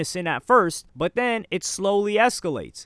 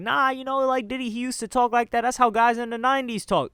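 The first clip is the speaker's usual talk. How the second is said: higher mocking voice of the excuse-makers; mimicking the crowd's excuse, higher and sing-song; mocking other people; 6 semitones higher than usual. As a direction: parody the people making excuses, pitched up. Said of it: "everyone else is talking about".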